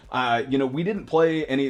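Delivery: ordinary delivery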